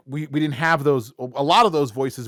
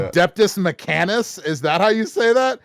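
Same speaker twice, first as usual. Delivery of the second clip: puzzled reading aloud; 9 semitones higher, 6 dB louder than usual. Retold or imitated. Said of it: "going like".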